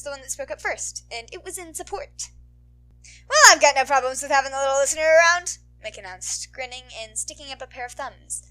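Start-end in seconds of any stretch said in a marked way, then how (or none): 3.3-5.6 big, loud, cheery hero voice; loud radio host. Acted. Mike cheering up the lost kid, loud. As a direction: boom out the reassurance.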